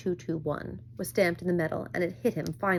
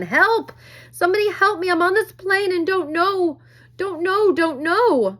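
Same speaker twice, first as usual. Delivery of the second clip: high, loud desperate screams for help; boy screaming into a radio mic, terrified; panicked screaming; 12 semitones higher, 10 dB louder, slower than usual. A narrator's reading. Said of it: "he began to scream into the microphone".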